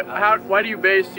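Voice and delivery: usual speaking voice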